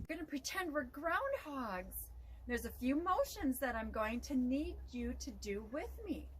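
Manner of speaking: ordinary delivery, nothing marked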